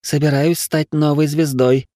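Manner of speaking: slow, smirking line. Acted. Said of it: "усмехнулась она".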